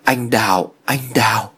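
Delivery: lightly acted feverish calling; delirious mumbling. A narrator's reading. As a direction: repeat the name as if barely conscious. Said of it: "miệng của Thiện vẫn đang mấp máy".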